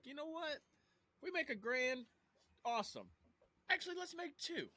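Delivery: very high-pitched put-on voice; imagined business owner. Owner talks self into a bigger margin, imagined voice. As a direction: go high and musing, pausing between thoughts. Imitates listeners pricing a job.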